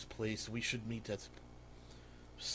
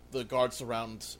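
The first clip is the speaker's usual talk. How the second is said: normal talking voice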